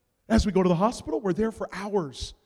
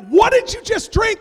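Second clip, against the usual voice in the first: much higher, louder shocked question; alarmed husband; 14 semitones higher, 12 dB louder than usual. Retold or imitated. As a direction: ask in horror. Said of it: "And I'm like".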